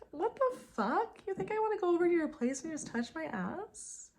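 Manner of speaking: outraged she can't believe he asked; outraged disbelief. Real, not acted. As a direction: shriek it in shocked disbelief. Outraged at his invitation.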